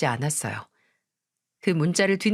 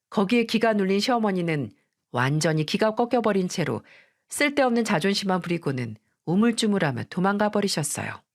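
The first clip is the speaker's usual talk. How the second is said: usual speaking voice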